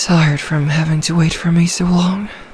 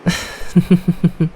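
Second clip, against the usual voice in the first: amused creature chuckle; 2 semitones higher than usual. Acted. Creature chuckling at her captive's fear of drowning.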